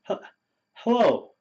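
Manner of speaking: ordinary delivery, nothing marked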